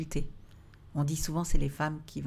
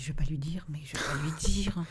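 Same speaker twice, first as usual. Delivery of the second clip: whispered thought; 2 semitones lower than usual. Retold or imitated. Answering the voice in her head.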